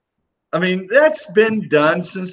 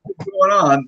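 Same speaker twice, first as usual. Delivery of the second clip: mock disbelief; 4 semitones higher than usual. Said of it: "You're like".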